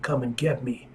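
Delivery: normal talking voice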